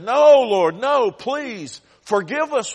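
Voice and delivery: high, loud begging no, Lord; pleading cry. A narrator's reading. Preacher acting Amos begging the Lord to forgive. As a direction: plead desperately for mercy. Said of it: "and Amos said".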